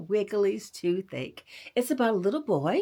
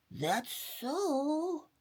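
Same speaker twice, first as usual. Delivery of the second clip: mild exclamation; 7 semitones higher, 6 dB quieter than usual. Acted. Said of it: "exclaimed Grandma".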